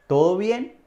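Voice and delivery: "¿Todo bien?" rising high at the end; questioning tune. Shown as a demonstration. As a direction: ask "¿Todo bien?" loudly, with the pitch climbing. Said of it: "se usa más como pregunta". ("¿Todo bien?" como pregunta, subiendo el tono)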